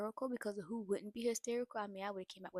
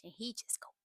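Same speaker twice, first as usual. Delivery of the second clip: usual speaking voice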